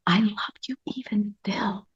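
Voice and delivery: breathless whisper, "I love you even still" with no voice at all. Shown as a demonstration. Speaking to her own shamed inner child, whispering. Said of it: "You can act out".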